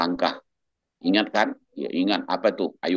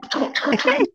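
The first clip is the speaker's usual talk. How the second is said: imitated sobbing; 3 dB louder, faster than usual.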